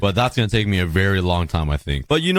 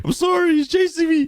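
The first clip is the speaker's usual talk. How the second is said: high, squealing mock panic; panicked squeal. Real, not acted. Player fleeing an angry calf, squealing in mock panic. Cow's calf chasing him.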